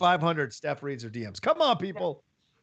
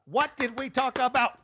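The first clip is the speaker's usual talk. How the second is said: higher, insistent scolding question; mock scolding; 7 semitones higher than usual. [name] scolding her husband, insistent mock reproach. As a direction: press the question like a cross partner.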